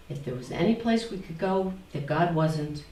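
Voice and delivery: ordinary delivery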